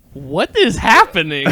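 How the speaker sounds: high, loud, slow laughing; laughing disbelief. Real, not acted. Reader laughing at the chaos, laughing disbelief. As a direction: crack up in confusion.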